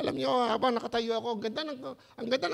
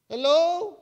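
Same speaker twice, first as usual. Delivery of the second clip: loud call; 6 semitones higher, 9 dB louder than usual. Real, not acted. Preacher calling out to congregation.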